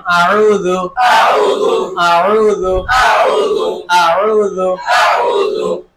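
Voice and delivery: আউযু said whole and drawn out, the last very slow; teacher modelling the whole word for students; joined pronunciation. Shown as a demonstration. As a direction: blend আউযু into one stretched word. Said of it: "এবার একসাথে উচ্চারণ".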